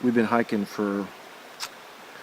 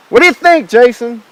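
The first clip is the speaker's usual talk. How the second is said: calling out; 12 semitones higher, 12 dB louder than usual. Real, not acted. Calling out to Jason.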